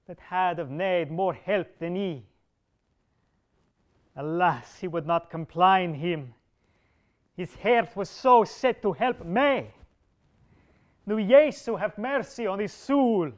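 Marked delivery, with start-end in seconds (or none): 4.2-13.3 rising, louder grief-stricken cry; grieving lament. A narrator's reading. Lucan's fall grieves Sir Bedivere, grieving and louder. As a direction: mourn the dying duke aloud. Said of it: "Then Sir Bedivere wept".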